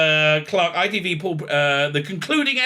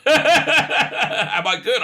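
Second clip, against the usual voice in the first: laughing through the question; 8 semitones higher, 4 dB louder, much slower than usual. Real, not acted. Host laughing at his own Samaria pun, laughing. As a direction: giggle it out, amused at yourself.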